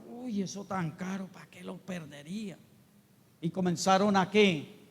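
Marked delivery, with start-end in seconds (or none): 0.1-2.6 soft, scornful muttering over the waste; scoffing murmur. Retold or imitated. Onlookers murmuring against María over costly perfume, soft scoffing. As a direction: grumble it under your breath, disapproving. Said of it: "a burlarse y a murmurar".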